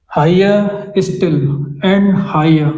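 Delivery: drawn out; slow scansion. Shown as a demonstration. Teacher reads the line slowly for scansion, drawn out. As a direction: stretch it to show the beat. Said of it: "इस तरीके से ये pattern बना".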